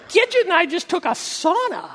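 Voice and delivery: high, cheerful pet voice; dog's voice. Pretend. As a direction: voice the dog greeting his owner. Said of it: "That's how he talks".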